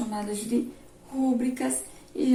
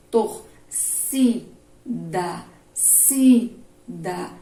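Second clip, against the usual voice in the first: Torcida broken up slowly, "ci" loud, then "sida" repeated; stress on penultimate syllable; 3 semitones lower, 6 dB louder, much slower than usual. Shown as a demonstration. Teacher drilling the strongest syllable for pupils, loud stressed "ci" in Torcida. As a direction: punch the "ci" in Torcida and again in sida. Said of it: "a penúltima é a mais forte".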